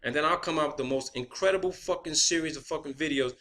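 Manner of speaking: usual speaking voice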